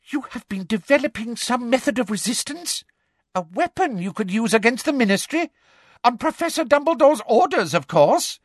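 high, thrilled accusation; excited. A narrator's reading. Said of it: "eyes seemed to pop with excitement".

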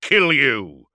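slow, thunderous war-machine voice; booming threat. A narrator's reading. Dreadnought orders his enemy out to die, booming. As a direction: boom it out slowly at full force. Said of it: "boomed Magnoric".

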